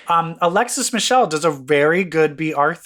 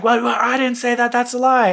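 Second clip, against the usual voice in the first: high-pitched mock protest; imitating an indignant denier; indignant denial; 8 semitones higher, 4 dB louder than usual. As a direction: retell a defensive denial, pitched up. Retold indignant denial in quarrel.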